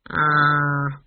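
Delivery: low, drawn-out doubtful sound; gloomy. A narrator's reading. Granny answers Esk's hopeful plan, gloomy. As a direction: let it out heavily, low. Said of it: "said Granny gloomily".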